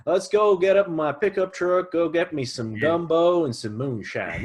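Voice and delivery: high, loud, slow hammed-up Southern drawl; southern accent. Showing off the Southern accent to a friend, hammed up. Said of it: "I love that accent, like, the southern one".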